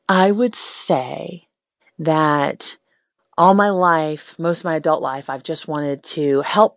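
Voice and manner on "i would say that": stretched out slowly, rising on would; haltingly slow start to a confession; slow, hesitant. Real, not acted. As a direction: draw it out, working up courage. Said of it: "if I'm completely honest".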